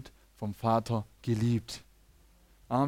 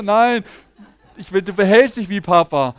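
laughing reply; 5 semitones higher, 13 dB louder than usual. Retold or imitated. Father answers daughter, laughing.

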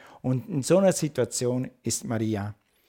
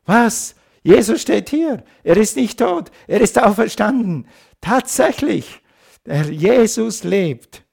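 excited proclamation; 7 semitones higher, 7 dB louder than usual. Real, not acted. Resurrection climax in sermon.

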